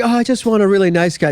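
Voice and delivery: lightly hammed-up wishing voice; mock wistful wish. Voicing women's wish in mockery.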